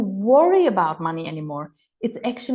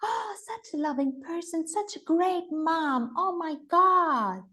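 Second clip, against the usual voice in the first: touched admiration; 8 semitones higher, 5 dB quieter than usual. Retold or imitated. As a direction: sigh out tender admiration. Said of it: "We all go like".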